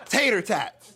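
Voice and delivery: high, loud punchline; nervous comic delivering his joke; eager punchline. Retold or imitated.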